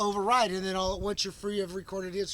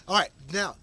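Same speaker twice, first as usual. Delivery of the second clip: normal talking voice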